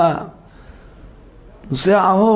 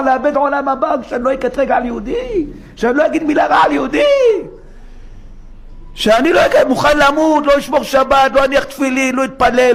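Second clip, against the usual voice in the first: fervent shout; 6 semitones higher than usual. Acted. Preacher voicing Jonah's resolve.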